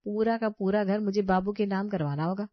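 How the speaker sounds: usual speaking voice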